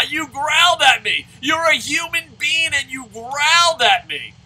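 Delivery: high, shouted ridicule; hammed-up taunting at full volume; loud mocking jeer. Man jeering at an old person who growled, loud mocking shout. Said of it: "pointing and laughing".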